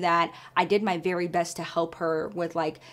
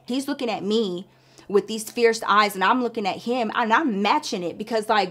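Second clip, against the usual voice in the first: normal talking voice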